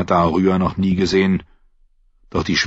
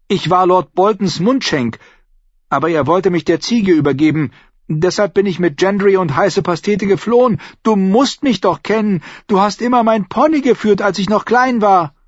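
much higher tearful plea; tearful pleading; 11 semitones higher, 4 dB louder than usual. A narrator's reading. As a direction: plead to be recognized.

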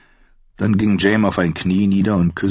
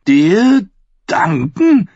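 very slow, higher, choked disbelief; 6 dB louder, much slower than usual. A narrator's reading. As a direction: choke on the words. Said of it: "mit erstickter Stimme". (mit erstickter Stimme, sehr langsam)

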